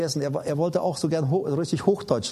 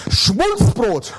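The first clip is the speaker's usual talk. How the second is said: loud, higher schoolboy blurting Schmulzbrot; imitating the classmate; 8 semitones higher, 9 dB louder than usual. Schoolboy answering his teacher in class, proudly shouting Schmulzbrot. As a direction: proudly shout out the mispronounced word. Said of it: "Und was hast du von deiner Mama mitbekommen?".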